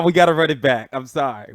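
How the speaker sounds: ordinary talk, nothing marked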